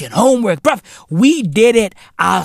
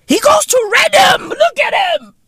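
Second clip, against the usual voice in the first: mock bragging shout. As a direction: yell it out like a proud boasting parent. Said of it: "Motherfuckers wanna brag like".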